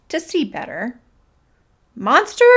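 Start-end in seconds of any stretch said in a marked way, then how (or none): 1.9-2.4 high, loud call; shouting. A narrator's reading. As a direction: shout a challenge at the monster. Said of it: "he shouted".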